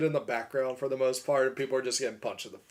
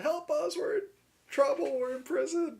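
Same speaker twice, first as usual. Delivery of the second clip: very high, thin plea for help; a lightly voiced high, desperate cry; pleading captives; 14 semitones higher than usual. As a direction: beg like a trapped prisoner. Voicing trapped creatures in video.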